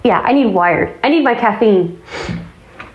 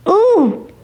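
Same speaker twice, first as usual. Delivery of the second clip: sudden high ooh of alarm; shocked ooh; 10 semitones higher than usual. Real, not acted. To the viewers about the nearby tornado, an alarmed ooh. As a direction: gasp it out, alarmed.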